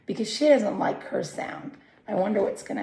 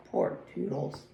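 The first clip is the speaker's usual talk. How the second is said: plain reading voice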